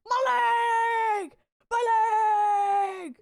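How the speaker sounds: loud, high hammed-up yell of pain; mock pain cry. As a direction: yell it like a cartoon injury.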